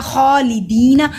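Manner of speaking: खालिदीना with long khaa and long dee; slow recitation, stretching दी; lengthened vowels. Shown as a demonstration. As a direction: hold खा and दी in खालिदीना. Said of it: "दी को लंबा करेंगे".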